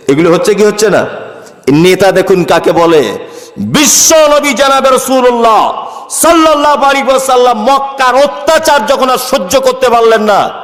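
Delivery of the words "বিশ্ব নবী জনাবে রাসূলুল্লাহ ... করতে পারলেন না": high, ringing sermon delivery; impassioned preaching. Acted. Preacher telling of oppression in Mecca, impassioned high declamation. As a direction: proclaim it to the whole crowd.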